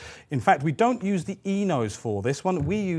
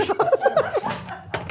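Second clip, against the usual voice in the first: high burst of laughter. Real, not acted.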